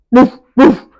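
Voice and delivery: loud, angry dog woof; fierce bark. A narrator's reading. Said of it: "barked a fierce, angry voice".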